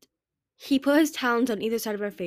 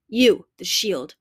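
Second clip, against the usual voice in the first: curt command; 9 dB louder, much slower than usual. Acted. Queen giving curt orders.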